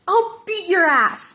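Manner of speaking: slow shouted threat; yelling. A narrator's reading. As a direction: yell it at the rival. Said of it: "Bakugo yelled".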